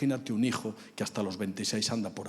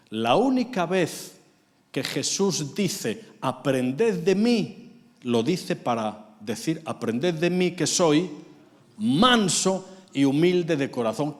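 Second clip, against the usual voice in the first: slow preaching; 4 semitones higher, 6 dB louder, slower than usual. Real, not acted.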